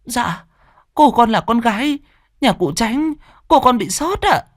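high, timid and apologetic; humble maid apologising to a stranger; servant girl voice. Acted. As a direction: beg forgiveness for bumping him.

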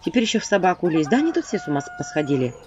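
plain reading voice

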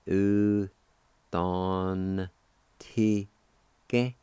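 Cherokee word in separate, drawn-out syllables. Shown as a demonstration. Teacher shows how kindly is pronounced, syllable by syllable. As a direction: sound it out piece by piece with gaps. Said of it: "pronounce the N sound with the D A like this".

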